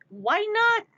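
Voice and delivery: high, loud indignant retort; playfully defiant comeback; mock protest. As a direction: throw it back, whiny and loud. Answers imagined objection.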